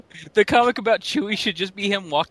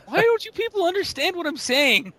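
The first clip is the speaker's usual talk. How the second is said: higher, pleading whine; exasperated nobody-listens rant; lightly voiced frustrated Wookiee; mock Chewie complaint; 7 semitones higher than usual. Riffing as Chewie.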